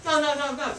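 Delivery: high, loud string of denials; hasty protest. Retold or imitated. Caller denies he is selling, high loud protest. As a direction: mimic a caller cutting in to protest.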